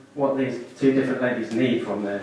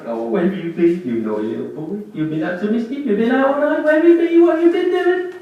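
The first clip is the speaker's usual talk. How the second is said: mock telling-off that rises higher and louder; fond scolding; 9 semitones higher, 4 dB louder than usual. Cat owner greeting her cat home in the morning, mock scolding. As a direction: scold a naughty boy fondly, building up.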